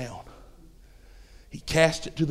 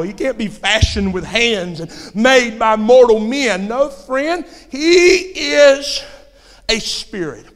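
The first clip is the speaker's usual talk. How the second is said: higher, rising preacher's cry; full-force preaching; 5 semitones higher, 8 dB louder, slower than usual. Acted. Preacher declaring what God is to the congregation, full-force preaching. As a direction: build to a drawn-out, loud declaration.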